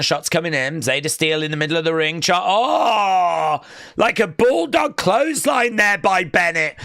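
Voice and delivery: ordinary talk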